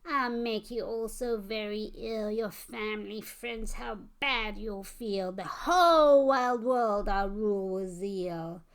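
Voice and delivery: a hammed-up villain monster crowing; gloating monster. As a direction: gloat over the world, then crow in triumph. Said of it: "It shrieked with joy".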